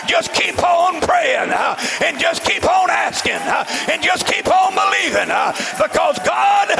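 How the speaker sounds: shouted, driving revival sermon; fiery church exhortation; full-force preaching. Real, not acted. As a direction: preach it hard in punched bursts, pitch climbing.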